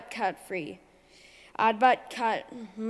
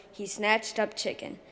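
ordinary delivery

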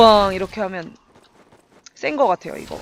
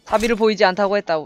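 ordinary delivery

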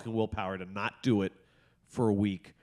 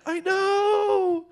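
high, far-from-usual excited outburst; giddy dad squeal; 4 dB louder than usual. Retold or imitated. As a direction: squeal back in shared excitement. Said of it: "she gets all excited".

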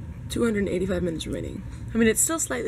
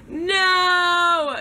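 loud high cry of frustration; frustrated shout; 10 semitones higher, 7 dB louder than usual. Real, not acted. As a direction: yell out in dismay. Missed photobomb, cries out.

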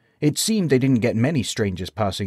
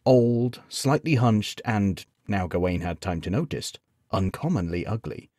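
usual speaking voice

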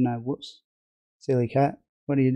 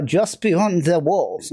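slow, higher mock-epic narration; dramatic trailer read; 4 semitones higher, much slower than usual. Streamer reading the game intro aloud, mock-epic.

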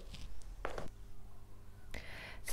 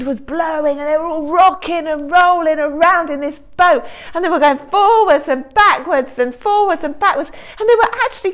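higher and louder, rising alarm; dramatic storm telling; 8 semitones higher, 8 dB louder than usual. A narrator's reading. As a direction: tell it as the boat rocks. Storm scene grows.

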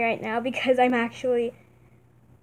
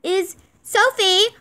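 slow, loud show announcer; 7 semitones higher, 6 dB louder than usual. Pretend. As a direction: call out the placings like a ringmaster. Announcing the placings.

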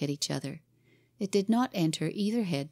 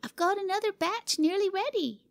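put-on high, hurrying child's call; impatient girl's cry; 13 semitones higher than usual. Cora urging Jimmy back: impatient high girl voice. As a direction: hurry him along.

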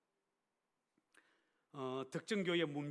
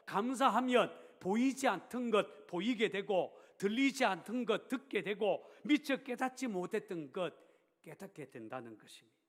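loud, raised-pitch sermon climax; high, emphatic pulpit delivery; forceful preaching; 6 semitones higher, 8 dB louder than usual. Real, not acted. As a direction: drive each promise home to the congregation.

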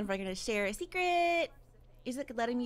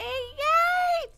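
very high, loud squeal; excited cheer; 14 semitones higher, 9 dB louder than usual. Real, not acted. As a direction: squeal it out in celebration. Celebrates the child's birthday.

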